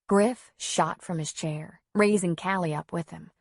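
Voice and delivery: ordinary delivery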